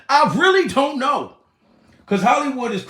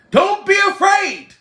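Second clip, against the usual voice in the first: high, loud belted cry; 10 semitones higher, 5 dB louder than usual. Retold or imitated. Singer urging [name] onward, loud high belt. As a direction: belt it out at the top. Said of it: "That's what [name] allegedly was saying".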